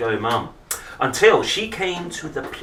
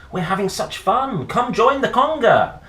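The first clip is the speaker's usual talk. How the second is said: pleading wild mums; 12 semitones higher, 6 dB louder than usual. Acted. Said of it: "the wild mums wailed".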